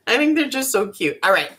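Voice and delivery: ordinary talk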